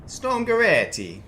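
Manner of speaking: high, loud, drawn-out Italian accent. Shown as a demonstration. Streamer guessing at the storm's Italian name, in a showy Italian accent. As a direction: put on a big Italian accent, slow and high-pitched. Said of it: "in Italian accent".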